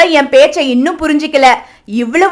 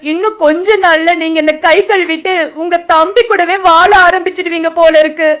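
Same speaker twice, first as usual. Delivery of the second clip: tearful fear; 3 semitones higher than usual. Acted. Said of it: "எனக்கு ரொம்ப பயமா இருக்குங்க".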